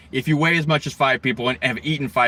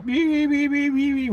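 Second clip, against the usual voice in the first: high, clipped electronic beeps; dial beep imitation; 12 semitones higher, slower than usual. Machinist imitating his dial indicator beeping. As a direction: mimic a test indicator beeping.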